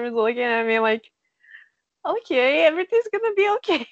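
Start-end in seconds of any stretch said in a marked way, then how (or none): 2.0-3.9 high, quick placating voice, imitated; mock reassurance. Sister looking at her sobbing sibling, reassuring. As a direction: act out a sister soothing someone sobbing.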